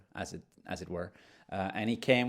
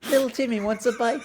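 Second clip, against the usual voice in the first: put-on high, loud child voice; little kid voice; 11 semitones higher, 4 dB louder, faster than usual. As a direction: shout it like an excited little boy. Voicing a kid character.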